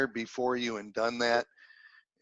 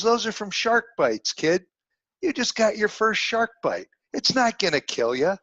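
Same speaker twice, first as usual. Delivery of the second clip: a mentor's voice lifted higher, gently firm; higher voiced mentor; 8 semitones higher than usual. Old mentor reassures a humiliated young man, higher voice. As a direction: play the old mentor talking sense into a kid.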